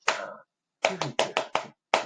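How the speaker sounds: very soft, near-whispered rhythm syllables; under the breath. Shown as a demonstration. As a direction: murmur the rhythm along with tapping. Counting rhythm under breath while tapping.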